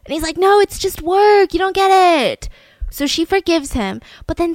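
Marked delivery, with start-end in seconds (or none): 0.4-2.5 defensive husband. Retold or imitated. Husband caught with another woman excuses himself to his wife, mock defensive. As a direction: imitate a husband's whiny, dragged-out excuse.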